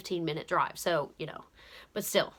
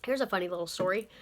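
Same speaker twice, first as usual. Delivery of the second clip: usual speaking voice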